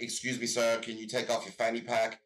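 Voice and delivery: mock official request, pitched low; hint of a guard giving a polite order; American guard. Voices an armed American guard.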